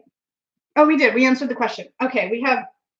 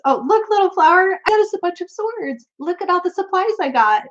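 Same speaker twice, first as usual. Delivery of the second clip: proud little thief; 7 semitones higher than usual. Pretend. Voicing the card's sword thief.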